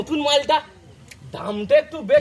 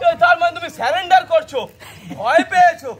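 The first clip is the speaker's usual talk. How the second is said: loud, higher-pitched challenge; scandalised, raised-voice questioning; shouting in disbelief; 4 semitones higher, 3 dB louder than usual. Acted. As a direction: raise your voice, incredulous at the surrender. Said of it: "এত চিৎকার করছো কেন".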